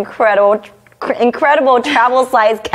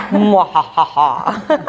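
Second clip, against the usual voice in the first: evil laugh; much slower than usual. Pretend. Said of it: "you can be on the mic like".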